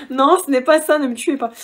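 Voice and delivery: high, loud laughing protest; mock panicked plea. Vlogger to viewers after a silly joke, loud mock plea. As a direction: beg them not to kill you over the joke, hammed up. Joke about camelback as chicha.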